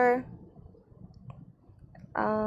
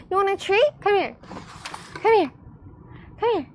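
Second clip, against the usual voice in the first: high excited pet voice; talking to a dog; 10 semitones higher, 3 dB louder than usual. Real, not acted. Calling dog with a treat.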